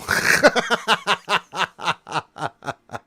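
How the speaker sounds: long, loud cackling laugh; high, rapid-fire belly laugh; giggling fit. Real, not acted. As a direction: crack up helplessly at your own quote. Cracks up after movie quote.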